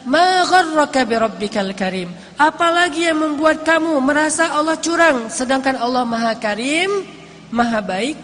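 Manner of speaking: emphatic sermon challenge; challenging preaching. Acted. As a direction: confront the listener with conviction. Preacher challenges listeners with scripture.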